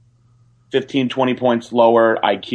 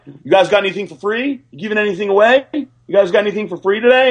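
much higher, fast, pushy asking; barging-in beggar; 9 semitones higher, faster than usual. Retold or imitated. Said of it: "barge right in and be like".

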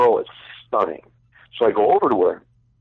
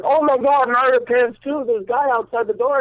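much higher, louder mock alarmed woman; mock panicked stripper; 4 dB louder than usual. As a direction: squeal it like a startled stripper. Stripper alarmed he drops pants.

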